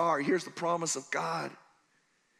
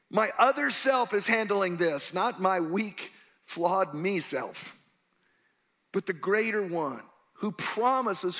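ordinary talk, nothing marked

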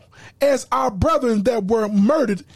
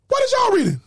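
higher-pitched challenging question; demanding, raised-pitch question; insistent repeat; 10 semitones higher, faster than usual. Real, not acted. Presses the same question, higher.

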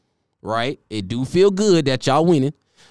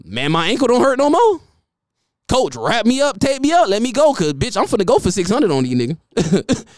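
much higher and louder, pleading; eager injured player; 9 semitones higher, 3 dB louder than usual. Retold or imitated. Player begging coach to play against weak team, high eager. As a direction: beg the coach to let you play. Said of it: "he gonna be like".

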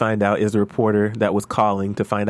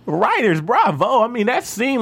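excited; 10 semitones higher, slower than usual. Real, not acted. Raving about the writing.

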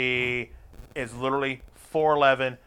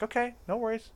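soft placating okay; 8 semitones higher, 9 dB quieter than usual. Retold or imitated. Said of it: "I'm like".

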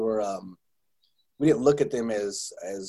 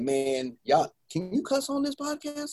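put-on kid voice; 8 semitones higher, 4 dB quieter, slower than usual. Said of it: "I tell my kids this".

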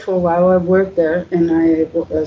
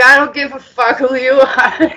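defiant shout; 7 semitones higher than usual. Retold or imitated. Retold reply to Brad's challenge.

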